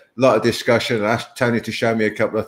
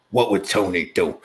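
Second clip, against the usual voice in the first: lightly put-on, lower voice; imitated training catchphrase; 4 semitones lower than usual. Student quoting his training mates to their coach, lightly imitated. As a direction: mimic how the training mates used to talk. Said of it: "that's how we spoke when we were training".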